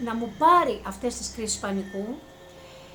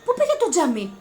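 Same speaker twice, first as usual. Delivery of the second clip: startled; 9 semitones higher, 6 dB louder than usual. Retold or imitated. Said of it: "Λέω".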